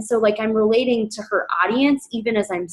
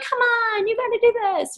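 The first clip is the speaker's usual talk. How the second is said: much higher mock pushy coaxing; pushy pleading; 12 semitones higher than usual. Coach mocking a pushy pitch to a hesitant mom, high and pleading. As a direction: parody a pushy recruiter begging. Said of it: "I'm not gonna be like".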